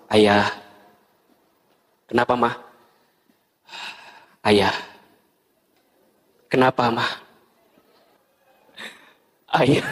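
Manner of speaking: lightly voiced wife and husband. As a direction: play a timid couple. Acted couple exchange.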